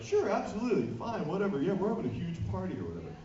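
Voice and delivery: mock breezy agreement. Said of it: "I was like".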